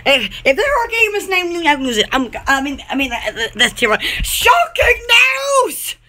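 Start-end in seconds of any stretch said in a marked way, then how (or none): 4.2-6.0 mock news announcement. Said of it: "announcing news dramatically".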